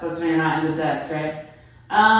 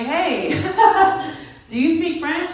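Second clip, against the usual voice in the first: eager; 9 semitones higher, much slower than usual. Retold or imitated. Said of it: "and I'm like".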